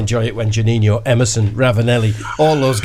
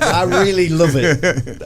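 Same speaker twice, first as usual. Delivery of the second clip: lightly imitated football manager, slow and higher; hint of an impassioned manager, breaking into laughter; Kevin Keegan; 5 semitones higher, slower than usual. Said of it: "sounding a bit like Kevin Keegan".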